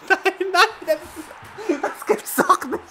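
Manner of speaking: high-pitched laughing protest, slowing at the end; laughing disbelief. Real, not acted. Gamer watching the opponent equalise, laughing high in disbelief. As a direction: laugh through the "nein" in despair.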